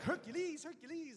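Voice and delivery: high squeaky character voice; Eddie Murphy impression. Mimicking Eddie Murphy roles.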